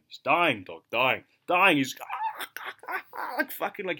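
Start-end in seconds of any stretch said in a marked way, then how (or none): 2.0-3.3 imitated high-pitched cackle; high laughing. Imitating his mate dying laughing on Discord, high laughing. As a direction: mimic a friend helpless with high laughter. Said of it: "you know, high laughing".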